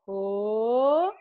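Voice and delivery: loud drawn-out oh; exaggerated oh. Shown as a demonstration. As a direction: belt out a big oh. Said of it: "we're gonna go".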